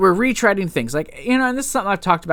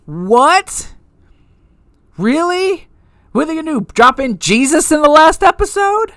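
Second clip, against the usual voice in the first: incredulous; 10 semitones higher, 6 dB louder than usual. Real, not acted. Incredulous at story choices.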